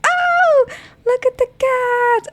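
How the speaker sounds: very high, slow gush; cooing squeal. Real, not acted. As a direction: melt over the cat, way up high. Gushing over the cat.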